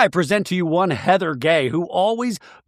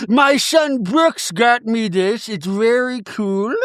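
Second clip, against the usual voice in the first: mimicking Meredith; 7 semitones higher, slower than usual. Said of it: "Meredith explaining her pajamas".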